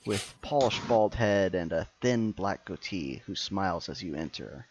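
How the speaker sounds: normal talking voice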